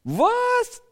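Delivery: high, loud outraged cry; shrieked indignation of an angry crowd; furious shouting. A narrator's reading. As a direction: shout it at him in acted disbelief. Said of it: "Jetzt werden sie richtig wütend".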